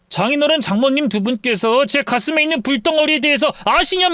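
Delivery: put-on a drunk man begging for sympathy; drunk pleading son-in-law. (서운함에 울부짖는 술 취한 사위)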